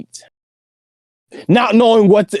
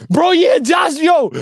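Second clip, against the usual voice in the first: loud, high-pitched exclamation; worked up, voice shooting up high; excited outburst; 12 semitones higher, 4 dB louder, slower than usual. Real, not acted. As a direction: burst in with animated agreement. Excited outburst agreeing after friend's disbelief.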